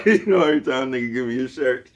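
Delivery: can't get it out for laughing. Real, not acted. Laughing about the shirt.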